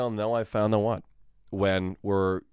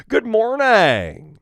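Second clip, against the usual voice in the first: slow, higher, louder keynote greeting; Tim Cook; 11 semitones higher, 9 dB louder, much slower than usual. Retold or imitated. Tech CEO greeting the keynote crowd, slow and high. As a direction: open a product launch with a big slow hello. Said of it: "Tim, as in Tim Cook".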